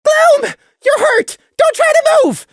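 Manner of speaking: very high, loud, urgent shout; very high, desperate yell. A narrator's reading. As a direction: shout into a wounded friend's ear. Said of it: "he shouted in Gloom's limp ear".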